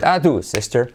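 ordinary talk, nothing marked

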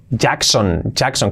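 "Jackson" with a full final o; as written. Shown as a demonstration. Teacher introducing the surname, spelt-out Jackson. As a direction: read "Jackson" as spelt, ending in "son". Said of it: "esa O tiende a hacer".

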